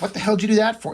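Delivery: mildly hammed-up anger; pissed off. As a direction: act out an indignant challenge. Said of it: "Spawn's pissed off".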